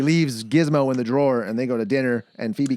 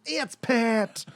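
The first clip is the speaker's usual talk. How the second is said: high, soft androgynous drawl; Pat voice; 10 semitones higher, 3 dB quieter than usual. Retold or imitated. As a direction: do the Pat character. Imitating SNL Pat voice.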